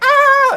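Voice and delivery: high yelp of pain, hammed up; mock pained shriek. Daughter's fingers crushed in the window, mock shriek. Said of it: "and she's like".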